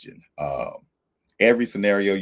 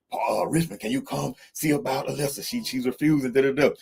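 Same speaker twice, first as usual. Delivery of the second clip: higher-pitched, hurried call for help over the radio; urgent radio call; 8 semitones higher than usual. Retold or imitated. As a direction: imitate a worried guard calling in. Staff calling on the walkie-talkie.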